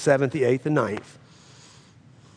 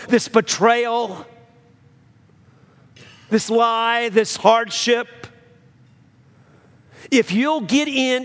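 high, louder preaching climb; full-force preaching; 8 semitones higher, 4 dB louder than usual. Acted. Pastor exhorting the church through trial, full preaching. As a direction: lift the congregation with each phrase.

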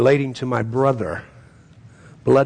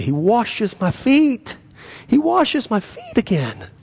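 much higher, slow, rapturous preaching; joyful lift; 11 semitones higher than usual. Real, not acted. Preacher exulting over being cleansed, much higher joyful lift. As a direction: lift the words up in joy.

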